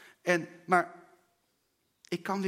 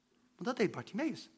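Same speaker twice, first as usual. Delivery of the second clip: ordinary talk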